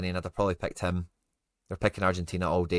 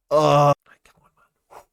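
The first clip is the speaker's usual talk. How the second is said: frustrated shout; 6 semitones higher, 13 dB louder than usual. Real, not acted. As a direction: yell it at the screen, fed up. Frustration at bad pull.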